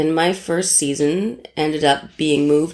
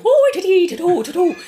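much higher, teasing mock Irish accent; 14 semitones higher, 4 dB louder than usual. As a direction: imitate a terrible Irish lilt while laughing. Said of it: "this terrible Irish accent".

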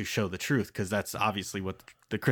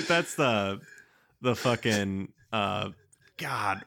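usual speaking voice

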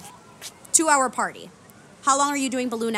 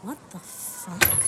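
whispered disbelief; 3 semitones lower, 6 dB quieter, much slower than usual. Acted. Baffled at new stage name.

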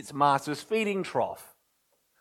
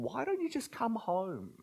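parent's plea; 7 semitones higher, 11 dB quieter than usual. Acted. Parent pleading with lost daughter.

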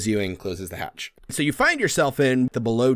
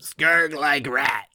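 very slow, drawn-out goblin; 2 semitones higher, much slower than usual. Acted. Skurg defends his rat to the party, goblin voice. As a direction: stretch each word in a goblin voice.

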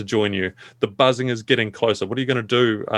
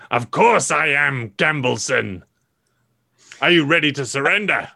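raspy villain voice. Acted. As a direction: rasp it out with menace. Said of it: "Uncle George's voice coming raspily".

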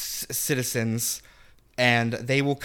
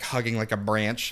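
normal talking voice

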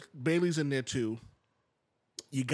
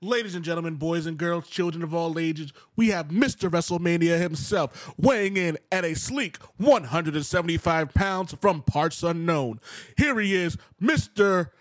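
booming ring announcer intro, slow, building louder; ringmaster; 4 semitones higher, 7 dB louder, slower than usual. Pretend.

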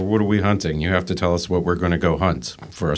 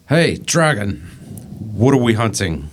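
calling up; 3 semitones higher than usual. Acted. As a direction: shout the question up at the beast. Calls up to the dragon.

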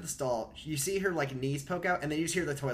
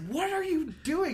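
high, drawn-out mock bewilderment; incredulous question; 3 dB louder than usual. Mark Molina finds Ben at his desk, incredulous. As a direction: imitate a baffled demand, slowly.

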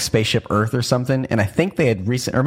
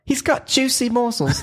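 much higher mock British accent; 14 semitones higher than usual. Asked to try the phrase in a British accent, mock British. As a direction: say it like a prim British speaker, pitched up. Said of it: "try saying it with a British accent".

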